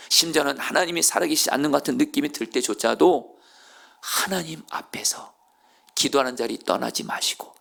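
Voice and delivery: ordinary talk, nothing marked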